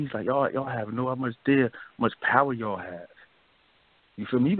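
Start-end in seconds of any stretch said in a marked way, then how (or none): none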